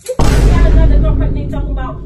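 furious, loud accusation; mad. Real, not acted. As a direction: explode at the cheating partner. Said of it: "why are you getting mad for?".

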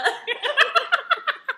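big high burst of laughter; sudden loud high cackle. Real, not acted. Laughing at own accent bit.

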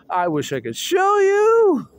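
high, slow excited plea; excited wish. Real, not acted. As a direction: gush it out. Excited about video.

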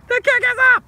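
high, slow triumphant commentary call; excited sports announcer. Pretend. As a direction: roar it like the crowd's going wild. Announcer calling the winning kick.

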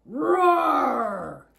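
loud, drawn-out storybook roar; dinosaur roar. Acted. Reader voices a huge dinosaur smashing in, loud roar. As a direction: let out a big, long roar.

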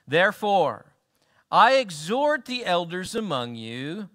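ordinary talk, nothing marked

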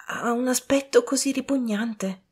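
high, hesitant servant. A narrator's reading. Servant hesitating before the order, high reluctant voice.

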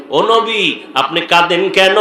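usual speaking voice

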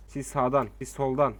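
ordinary delivery

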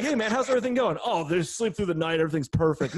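high, chirpy mock small talk, everything's fine; fake cheerful parent. Friend asking another dad, who fakes being cheerful and fine. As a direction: play the parent pretending all is perfect.

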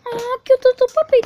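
high, loud squeal of adoration; gushing cooing. Real, not acted. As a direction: coo loudly up high at a puppy. Gushing over a puppy.

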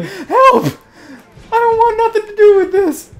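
panicked horse. Retold or imitated. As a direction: squeal like a terrified cartoon horse. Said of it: "That horse is like".

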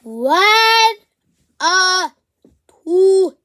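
high, loud burst; loud, excited yell. Real, not acted. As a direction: blurt the words in a rush of joy.

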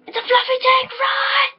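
high, frantic yell to run; panicked shout. Real, not acted. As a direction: scream a warning at teammates. Panicked call to flee the tank.

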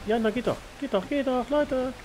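excited. Real, not acted. Puzzle finally solved in game.

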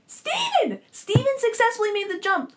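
high, loud burst of excitement; excited exclamation. Real, not acted. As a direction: shout the big reveal, thrilled. Thrilled reveal of a plot twist.

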